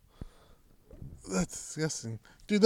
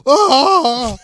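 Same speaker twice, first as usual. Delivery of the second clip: very high, loud cry of pain; hammed-up scream of pain; mock burn yelp; 11 semitones higher, 11 dB louder than usual. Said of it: "Why does that sound painful".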